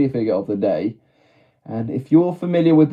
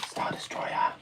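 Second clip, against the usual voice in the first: whispered reveal; 16 dB quieter than usual. Real, not acted. Dramatic reveal of the build.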